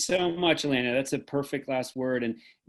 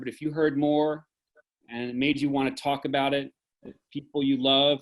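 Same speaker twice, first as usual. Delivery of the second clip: ordinary talk, nothing marked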